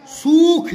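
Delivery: सूख said slowly with a long ऊ; long vowel sukh. Shown as a demonstration. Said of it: "सू छोटा कर दूंगा तो हो जाएगा सुख".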